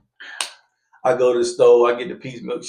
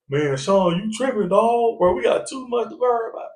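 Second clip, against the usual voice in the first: pleading; 12 semitones higher than usual. Retold or imitated. Co-defendant pleading.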